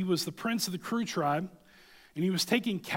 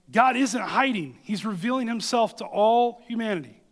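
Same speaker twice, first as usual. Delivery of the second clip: proclaiming; 6 semitones higher, 7 dB louder than usual. Real, not acted. Preacher proclaiming to congregation.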